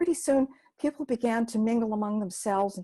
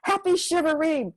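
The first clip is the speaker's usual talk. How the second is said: much higher and louder joyful shout; children shouting; 9 semitones higher, 4 dB louder than usual. Acted. Kids surprising Gima with a noisy shivaree, shouting gleefully. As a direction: yell it over the clanging pots. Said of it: "banging on them and shouting".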